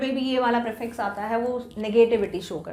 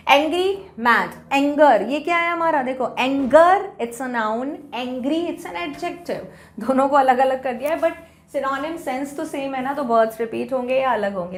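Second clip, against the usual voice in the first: usual speaking voice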